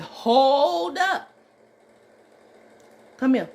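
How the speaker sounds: loud, high "hold up" to stop him; stopping an upset father at the elevator; firm call out. Retold or imitated. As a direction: call him back firmly. Stopping an angry man.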